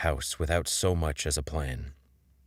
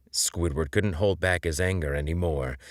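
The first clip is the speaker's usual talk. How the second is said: plain reading voice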